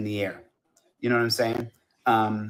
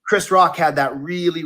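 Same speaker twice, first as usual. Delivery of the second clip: ordinary talk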